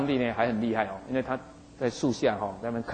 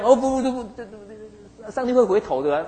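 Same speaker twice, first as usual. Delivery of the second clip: much higher, rapid no no no, alarmed; panicked no; 8 semitones higher than usual. Retold or imitated. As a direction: frantically wave it off, it can't be. Preacher voicing Jonah's panicked refusal. (惊慌地连声说不)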